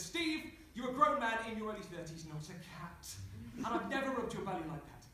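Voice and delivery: indignant voiced rebuke; exasperated scolding. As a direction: scold a partner like a child. Voiced partner scolds the narrator.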